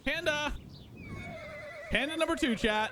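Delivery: high, delighted call of "Panda!"; bright excited shout to chat. Real, not acted. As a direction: spot it and call out, thrilled.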